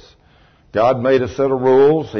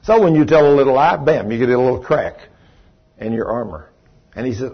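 ordinary talk

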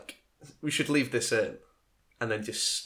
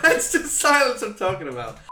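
loud, high, through laughter; real laughing; 11 semitones higher, 10 dB louder than usual. Real, not acted. Host losing it with co-host, real laughing. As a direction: crack up while getting the words out. Cracks up while talking.

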